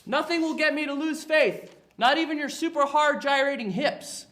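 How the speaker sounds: high, loud preacher protest; outraged pastor. Acted. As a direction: cry out like a scandalized pastor. Pastor resists witch in scene.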